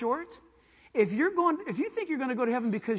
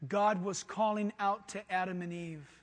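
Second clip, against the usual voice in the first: normal talking voice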